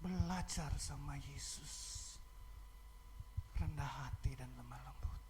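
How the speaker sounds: soft, slow, whispered "rendah hati dan lemah lembut"; hushed reverence. Acted. Pastor quotes Jesus to the congregation, whispered and slow. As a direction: breathe "lemah lembut" like a prayer. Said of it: "reverent hushed quote of Jesus".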